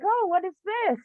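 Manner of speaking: high, light imitation of a chirpy lady; cheerful curiosity. Storyteller imitates lady browsing the shop, cheerful curiosity. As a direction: lift the voice high with bright interest. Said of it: "she's all cheerful".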